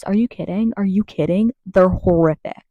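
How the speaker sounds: usual speaking voice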